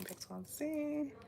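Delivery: high silly take; playful high voice. Shown as a demonstration. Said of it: "see how loud I really am".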